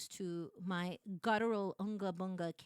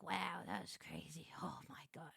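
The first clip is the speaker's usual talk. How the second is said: stunned hush; 4 semitones lower, 9 dB quieter than usual. Retold or imitated. Stunned quiet reaction.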